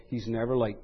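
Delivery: said low and soft, with calm certainty; quiet, settled. Shown as a demonstration. Preacher assuring his flock, softly certain. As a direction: let it land softly. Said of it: "suffice it to say".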